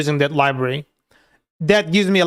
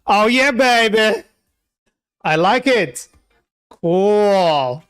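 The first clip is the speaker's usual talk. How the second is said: excited cheer; 3 semitones higher, much slower than usual. Real, not acted. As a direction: savour the win, stretching it out. Pleased his app finally works.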